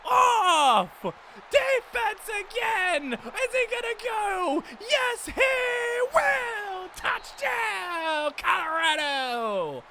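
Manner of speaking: shouting the big defensive score, elated; excited shouting. Real, not acted. Player celebrating his team's touchdown: elated shouting. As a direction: yell it out like the game's won.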